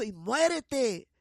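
retold curse. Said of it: "lo último le dijo".

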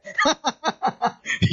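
amused laughter bursting out; rapid chuckling run; real laugh. Real, not acted. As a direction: laugh heartily at the anecdote. Laughs at pastor's reaction.

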